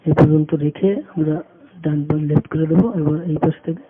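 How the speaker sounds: usual speaking voice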